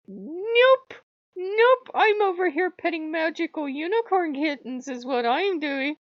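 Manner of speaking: high giddy squeal; high-pitched giddy mock voice. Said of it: "I'm like".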